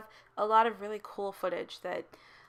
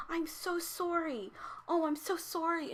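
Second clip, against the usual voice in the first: apologetic Canadian driver; 9 semitones higher than usual. Retold or imitated. Canadian driver apologizing to the other car, slow higher sorry. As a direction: play the overly sorry Uber driver, lightly. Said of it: "and he's like".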